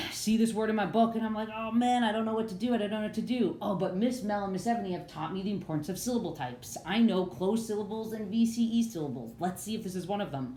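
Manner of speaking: mock worry, then working it out; puzzled student reader. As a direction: play a stumped reader talking it through. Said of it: "Let's say I'm reading".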